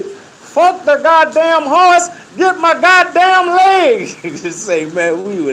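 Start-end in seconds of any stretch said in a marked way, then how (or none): 0.0-4.1 high, slow, furious bellow; angry yell. Retold or imitated. Captain caught in the strap yells about his leg, furious. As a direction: roar it like a man dragged by his leg.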